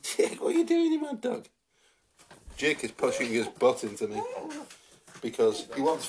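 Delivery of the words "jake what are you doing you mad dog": talking to the dog. Real, not acted. Talking to her dog Jake.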